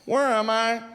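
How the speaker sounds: put-on high, lost drunk question; drunk confusion. Drunk man asks the bartender where he is, high and lost. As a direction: ask like you have no idea. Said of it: "the guy goes".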